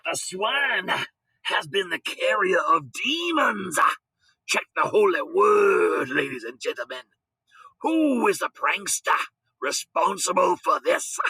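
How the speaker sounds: slow, high, booming sermon voice; thundering preacher. Acted. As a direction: denounce them from the pulpit. Preacher denouncing the prankster.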